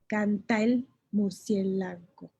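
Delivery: canta el murciélago said slowly, canta and el joined; slow demo of the sinalefa; slow syllable count. Shown as a demonstration. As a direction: tap out each syllable slowly. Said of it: "Son cinco sílabas al oído".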